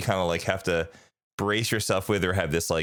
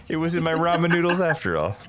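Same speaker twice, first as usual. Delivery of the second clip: high, giggling through the line; playful joke voice with laughter; laughing; 9 semitones higher than usual. Pretend. Joking continuation of Moana bit.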